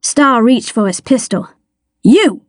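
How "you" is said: sharp, higher single word; barked challenge. A narrator's reading. Detective challenging a hidden figure, barked. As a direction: challenge someone with a short bark. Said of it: "he barked".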